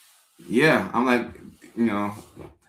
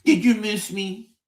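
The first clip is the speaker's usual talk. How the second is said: slow, higher needy ask; needy partner; 8 semitones higher than usual. Retold or imitated. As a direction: drag out the question like a clingy partner. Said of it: "they're like".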